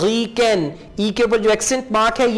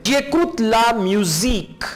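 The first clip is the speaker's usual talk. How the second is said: slow model reading; much slower than usual. Shown as a demonstration. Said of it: "teacher models French slowly".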